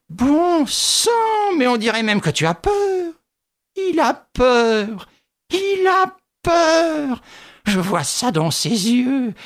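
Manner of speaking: high, slow, shrill mocking crone; cruel gloating. A narrator's reading. Widow to Lupin, gloating that he's afraid. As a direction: drag out the mockery.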